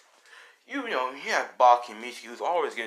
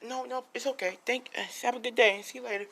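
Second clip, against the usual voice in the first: lightly reassuring, pitched way up; polite girl's voice; 11 semitones higher than usual. Acted. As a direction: act out a gentle, reassuring goodbye. She politely accepts apology, says goodbye.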